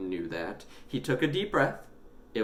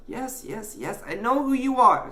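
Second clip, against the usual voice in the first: put-on exasperated boy; 7 dB louder than usual. A narrator's reading. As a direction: cut him off, fed up. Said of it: "exasperated now".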